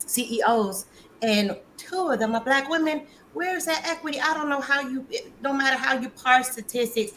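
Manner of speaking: high-pitched, indignant comeback; fed up, incredulous and high; exasperated retort. Acted. Indignant retort to the imagined disputer.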